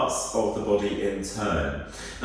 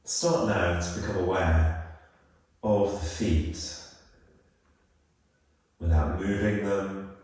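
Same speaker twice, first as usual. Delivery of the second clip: calm, unhurried body-scan voice with long pauses; guided meditation; 3 dB quieter than usual. Real, not acted. Yoga teacher guiding listeners through a body scan, calm guided meditation. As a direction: ease them into stillness, phrase by phrase.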